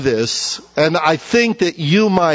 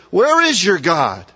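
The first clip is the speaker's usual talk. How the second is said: higher, jeering mocker's voice; mocking taunt; 5 semitones higher than usual. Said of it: "The mockers say".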